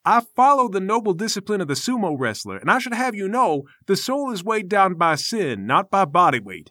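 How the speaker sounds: lightly voiced lofty master; a hint of preachy self-importance; pompous temple master. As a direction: defend your noble discipline with affronted dignity. Game master voices Namir.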